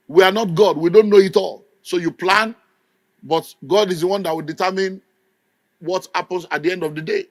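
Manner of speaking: normal talking voice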